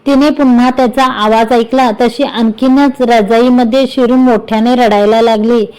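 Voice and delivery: usual speaking voice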